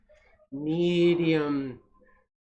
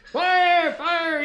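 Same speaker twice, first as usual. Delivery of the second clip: high loud alarm cry; high frantic shout of fire; 13 semitones higher, 6 dB louder than usual. A narrator's reading. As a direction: shout it to scare the birds off. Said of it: "he cried".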